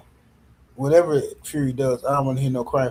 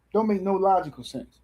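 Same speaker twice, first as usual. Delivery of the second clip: ordinary delivery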